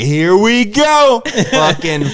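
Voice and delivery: high, drawn-out cocky excitement; hammed-up here we go; mock hype. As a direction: lay on the big-shot swagger, slow and high. Mocking his own hype.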